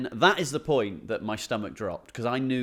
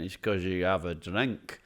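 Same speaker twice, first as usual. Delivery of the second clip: put-on Glaswegian accent; 5 semitones lower, 3 dB quieter than usual. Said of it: "do a version of an accent here".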